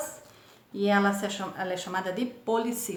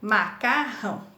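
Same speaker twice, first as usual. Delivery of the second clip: MA - CAR - RÃO, three separate beats; teacher sounding out MA-CAR-RÃO; split into syllables. Shown as a demonstration. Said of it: "Três sílabas".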